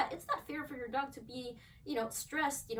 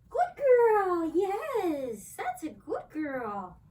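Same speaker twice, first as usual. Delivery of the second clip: much higher, louder burst of praise, then slowing; 7 semitones higher, 9 dB louder, much slower than usual. Real, not acted. Trainer rewarding the dog for holding still, loud high praise. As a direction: cheer the dog on big. Dog stays through garbage bag.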